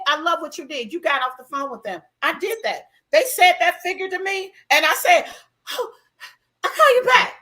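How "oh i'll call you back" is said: brisk brush-off. Retold or imitated. Retold phone call, brushing off.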